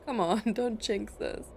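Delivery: ordinary talk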